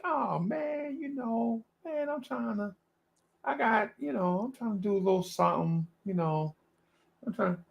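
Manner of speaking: put-on high, soft, mumbled young guy's voice; Cully impression. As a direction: do Cully, way up and hushed, laid back. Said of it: "your impression of him".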